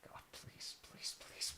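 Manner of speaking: breathless whispered begging; whispered plea. Real, not acted. Fan praying for Rodgers in a whisper. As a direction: whisper your prayer.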